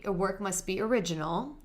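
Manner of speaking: normal talking voice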